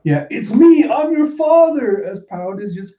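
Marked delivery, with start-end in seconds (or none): 0.3-2.0 high, loud, drawn-out cry; emotional cry. Acted. Father revealing himself to daughter, emotional cry. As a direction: melodramatic high wail, stretching the words. Acts out the comic's reunion.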